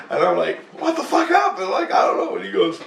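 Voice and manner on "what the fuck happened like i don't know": high-pitched mock bewildered question; retold bewilderment. Dreamer to wife on waking, high bewildered outburst. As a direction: act out baffled disbelief, pitched way up.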